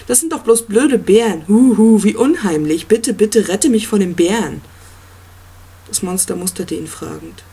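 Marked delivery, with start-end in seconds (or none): 0.1-4.6 mock-scared, louder sneering tone; sarcastic mockery. A narrator's reading. Boy mocks the monster's bears, louder and sarcastic. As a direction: ham up fake fear to ridicule him. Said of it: "hast noch nie was von Sarkasmus gehört".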